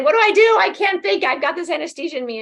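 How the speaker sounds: high, loud mock panic, easing off; acted fluster, starting high and settling; retold helpless plea. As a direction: replay your flustered call for help. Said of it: "I said".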